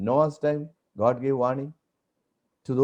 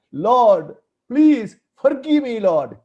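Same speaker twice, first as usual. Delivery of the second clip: pleading cry; 8 semitones higher, 7 dB louder, slower than usual. Shown as a demonstration. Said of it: "let my eyes be wet with tears".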